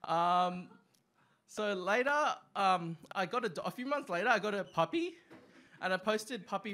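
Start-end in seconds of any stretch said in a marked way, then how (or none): none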